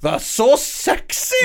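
leering Welsh accent, hammed up. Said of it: "Can you do a Welsh accent".

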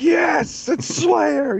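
very high screechy mock shout; imitated outraged fan. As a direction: screech it like a fanatic defending the band. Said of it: "She's like".

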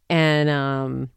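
ordinary delivery

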